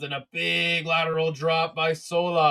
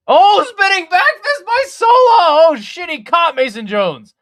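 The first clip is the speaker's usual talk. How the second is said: far higher, much louder yelling at the hit; excited shout; 11 dB louder than usual. Real, not acted. Commentator yelling as Sola's back fist lands.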